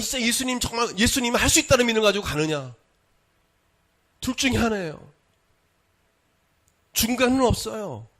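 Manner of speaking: high, driving pulpit delivery; forceful preaching. Real, not acted. Preacher pressing the congregation to choose belief or unbelief, forceful preaching. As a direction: drive each either-or question home, pitched high. Preaches the choice of two roads.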